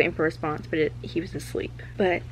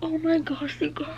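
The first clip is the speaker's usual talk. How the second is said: high, giddy exclamation; thrilled gasp of news; 7 semitones higher than usual. Real, not acted. Reader to viewers about the book, thrilled. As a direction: squeal it to your friends.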